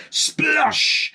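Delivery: high sung-out splosh; splosh sound effect. A narrator's reading. Narrator sounding the paint hitting the farmer, high splosh. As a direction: make the paint land with a splash.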